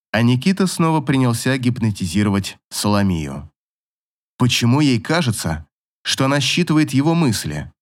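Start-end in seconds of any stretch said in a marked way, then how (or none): none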